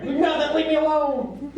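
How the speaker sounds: put-on high-pitched teenage protest; acted high, panicked teen whine; defensive teenager. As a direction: yell back through the door to be left alone. Teen Jesus answers banging mother.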